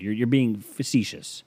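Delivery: normal talking voice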